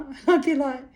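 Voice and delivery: usual speaking voice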